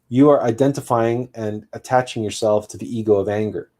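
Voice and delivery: usual speaking voice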